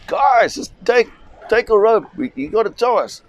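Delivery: retold yell from father to rescuers; imitating a desperate father calling out; dad shouting. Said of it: "my dad shouts".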